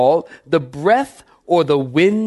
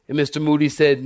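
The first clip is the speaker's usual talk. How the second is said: usual speaking voice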